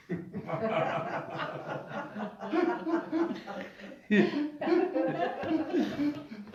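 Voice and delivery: high, helpless laughing fit; real sustained laughter; long laugh. Real, not acted. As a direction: crack up at your own story. Laughs at his own story.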